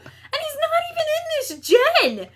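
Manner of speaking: high laughing disbelief. Real, not acted. Still laughing about boss.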